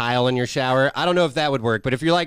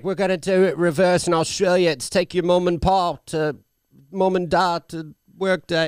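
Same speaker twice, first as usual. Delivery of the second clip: higher-pitched, wandering mock Australian; botched Australian accent; 7 semitones higher, 3 dB louder than usual. Mimicking an actress on take-your-child-to-work day, botched Australian accent. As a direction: attempt Australian, veering from Cockney to Irish. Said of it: "I can't fucking do Australian".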